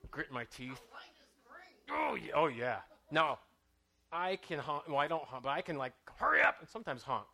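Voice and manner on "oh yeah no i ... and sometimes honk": normal talking voice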